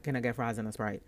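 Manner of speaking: low, fast, curt order; grumpy New York order; bad attitude. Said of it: "with the same attitude".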